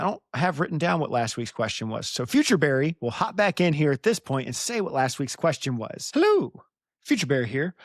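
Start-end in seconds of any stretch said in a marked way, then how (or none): 6.1-7.8 high, loud hello; sudden high, louder greeting; bright pop-in greeting. Pretend. Hops in as Future Barry.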